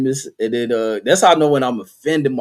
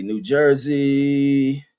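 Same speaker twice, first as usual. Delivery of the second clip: drawn out slowly; much slower than usual. Real, not acted. Typing slowly while searching.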